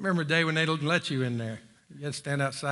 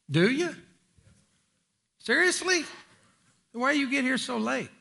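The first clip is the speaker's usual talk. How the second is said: mock challenge; 11 semitones higher, 4 dB louder than usual. Preacher teasing the congregation.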